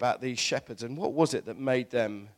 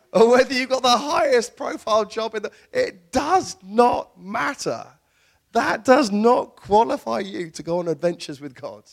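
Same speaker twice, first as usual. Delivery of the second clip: raised voice driving the point home; emphatic preaching; 8 semitones higher, 7 dB louder than usual. Real, not acted. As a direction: preach it forcefully, punching "does not". Preacher hammering home his point.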